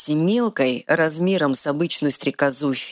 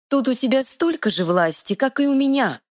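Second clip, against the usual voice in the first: high, quick little fairy voice; 11 semitones higher than usual. Acted. Fairy scolding the troll, high and bossy. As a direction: play a tiny sassy fairy, pitched high.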